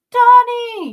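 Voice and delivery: high, loud yell of a friend's name; desperate yell. A narrator's reading. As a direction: scream his name across the snow. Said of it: "yelled Kane".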